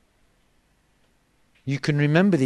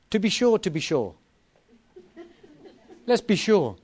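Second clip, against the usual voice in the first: Irish accent; 4 semitones higher than usual. Shown as a demonstration. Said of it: "I wish I could do an Irish accent".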